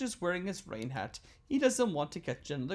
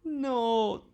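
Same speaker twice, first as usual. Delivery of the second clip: crying no; 6 semitones higher, 6 dB louder than usual. Acted. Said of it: "cried George".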